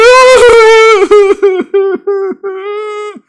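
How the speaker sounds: loud, high mock crying wail; hammed-up hysterical sobbing, long and high. Aunt after dropping the turkey, mock hysterical wail. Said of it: "crying hysterically".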